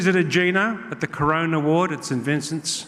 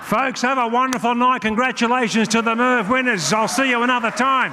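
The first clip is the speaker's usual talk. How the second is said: high, energetic farewell to the crowd; rousing sign-off; 7 semitones higher than usual. Real, not acted. Comic wishing folks a wonderful night, rousing sign-off. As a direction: send them off on a high.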